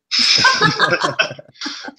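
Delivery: airy laugh, pitch jumping up; real laugh at her own joke; high breathy laugh. Real, not acted. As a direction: laughs off the insult.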